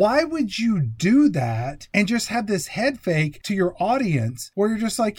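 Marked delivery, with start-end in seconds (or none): 0.0-3.0 raised, emphatic exasperation; worked-up film-critic rant; exasperated. Real, not acted. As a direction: hit each word in disbelief at the cheat. Exasperated rant about fake-out.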